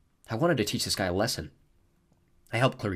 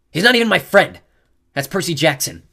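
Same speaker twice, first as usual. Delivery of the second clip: loud indignant shout; girl denying the boy is her boyfriend; yelling; 9 semitones higher, 11 dB louder than usual. A narrator's reading. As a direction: yell the denial. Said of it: "Clarice tugged her sword".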